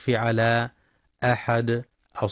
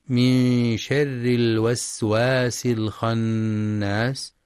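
slow melodic chant; Quran recitation; much slower than usual. Shown as a demonstration. Teacher reciting Surat an-Nas, slow chant. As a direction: recite slowly with tajweed.

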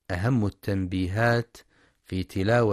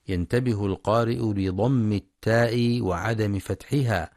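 ordinary talk

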